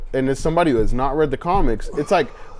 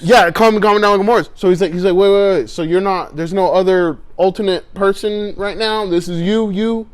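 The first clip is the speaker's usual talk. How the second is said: excited retelling; 5 semitones higher, 5 dB louder than usual. Retold or imitated.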